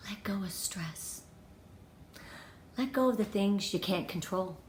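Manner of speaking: breathy whisper, barely voiced; whispered advice. Real, not acted. Whispering to viewers to let go of stress. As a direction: whisper it like a calming secret.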